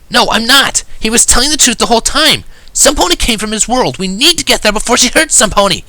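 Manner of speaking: loud, high-pitched frantic insistence; shocked and scared. A narrator's reading. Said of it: "she sounded shocked and scared".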